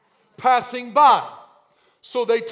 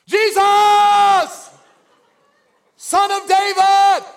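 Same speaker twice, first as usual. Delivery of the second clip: high, long, belted shout; defiant full-voiced cry; crying out louder; 9 semitones higher, 12 dB louder, much slower than usual. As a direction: holler the name with all your breath. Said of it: "he cried out all the more".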